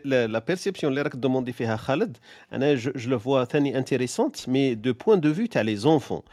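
usual speaking voice

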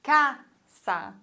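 casa split in two, CA stressed, sa falling away; penultimate stress. Shown as a demonstration. Teacher demonstrates the rule, casa stressed on the syllable ca. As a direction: punch CA, pause briefly, drop on sa in casa. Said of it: "die vorletzte Silbe wird betont".

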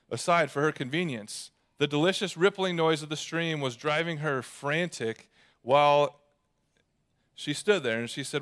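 ordinary talk

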